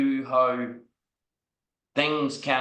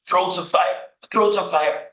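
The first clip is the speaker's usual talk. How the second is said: panicked gasp; 7 semitones higher, 4 dB louder than usual. Retold or imitated. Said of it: "I was like".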